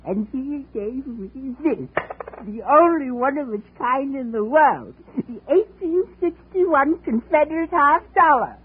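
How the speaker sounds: a proud collector crowing over his prize; excited. Acted.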